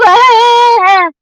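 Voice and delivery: a mocking cackle of disbelief; scornful laugh. Real, not acted. Laughing at his claim.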